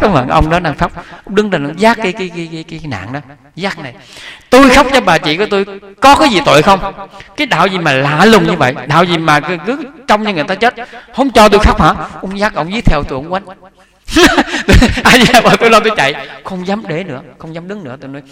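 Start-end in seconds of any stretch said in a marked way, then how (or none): 4.5-11.9 higher, louder indignant protest from the weeping brother; angry retort. Retold or imitated. 14.1-15.0 high burst of laughter. Real, not acted.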